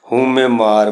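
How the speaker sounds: ਮਾਰ said as plain maar, no final i sound; maar without sihari. Shown as a demonstration. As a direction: read ਹਉਮੈ ਮਾਰ with ਮਾਰ ending flat in r. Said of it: "ਇਹ ਉਚਾਰਨ ਨਹੀਂ ਹੋਏਗੀ". (ਮਾਰ ਨੂੰ ਸਿਹਾਰੀ ਬਿਨਾਂ "ਮਾਰ" ਬੋਲੋ)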